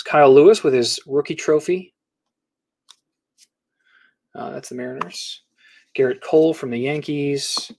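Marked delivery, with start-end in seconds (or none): 4.3-5.4 muttered aside. Real, not acted. Aside to himself while sorting cards.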